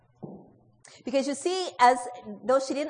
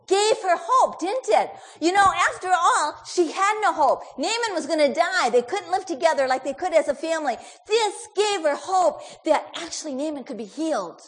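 louder and higher, fervent; rising preacher's fervor; 6 semitones higher, 10 dB louder than usual. Real, not acted. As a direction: lift it up as good news. Preaching about hope.

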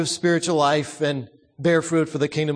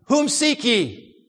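higher, slow Whom seek ye; mild call; 8 semitones higher, slower than usual. Retold or imitated. Jesus calling out to the arresting soldiers. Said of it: "Jesus cried out".

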